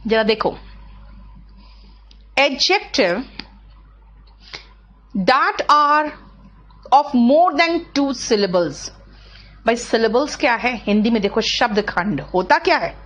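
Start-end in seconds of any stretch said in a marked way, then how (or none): 2.4-8.9 slow dictation. Shown as a demonstration. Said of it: "teacher dictating the rule".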